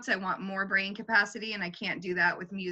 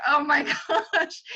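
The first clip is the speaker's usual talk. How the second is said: laughing oh my gosh; 6 semitones higher, 5 dB louder, slower than usual. Retold or imitated. Overwhelmed reaction to video.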